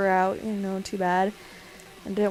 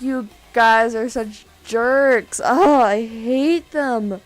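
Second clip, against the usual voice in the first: mock outrage; 9 dB louder, much slower than usual. Scolding friends after prank reveal.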